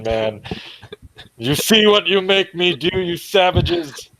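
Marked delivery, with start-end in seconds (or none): 1.4-4.1 in-character outburst. Acted. Dougal stomps the chameleon man's head, outraged high cry. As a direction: rail at the savages, pitched well up. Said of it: "and I will say".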